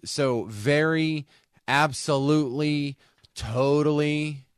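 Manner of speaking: slow, each word stretched out on its own; drawn-out drama words. Shown as a demonstration. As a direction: savor every word for drama. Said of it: "they consider this drama words".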